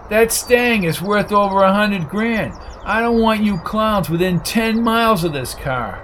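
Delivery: high, heated warning; angry warning. Acted. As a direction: warn them off the car. Coco warns men off his car.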